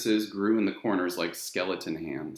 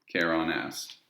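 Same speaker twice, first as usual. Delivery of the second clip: plain reading voice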